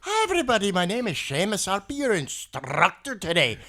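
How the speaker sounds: loud, high, cheery put-on Irish brogue; Irish accent. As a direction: introduce yourself in a hammed-up Irish accent. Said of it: "an Irish instructor named Seamus".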